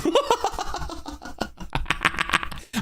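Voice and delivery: high, airy drawn-out laugh; breathy laugh. Real, not acted. Fan laughing at his player's awful shot, airy. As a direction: laugh helplessly at the miss.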